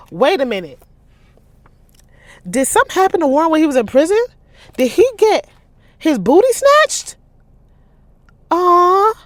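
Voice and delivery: loud, high, gossipy disbelief; shocked reaction. Real, not acted. Reader reacting to juicy prison twist, scandalized squeal. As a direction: gasp out the question in scandalized glee.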